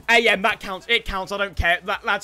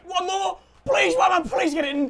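desperate pleading shout; 7 semitones higher than usual. Real, not acted. Pleading for a goal.

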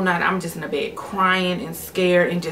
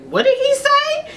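much higher, squeaky cousin voice; cousin's voice; 4 dB louder than usual. Retold or imitated. Cousin in the car asking what he said, high squeaky. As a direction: pitch way up for the cousin. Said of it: "my cousin's like".

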